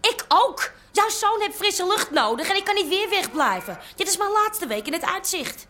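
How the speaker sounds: wife hitting back at her husband; angry retort. Acted. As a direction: snap back at him in frustration. Couple's heated domestic quarrel.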